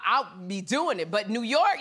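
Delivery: normal talking voice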